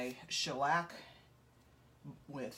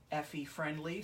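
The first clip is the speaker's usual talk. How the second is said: normal talking voice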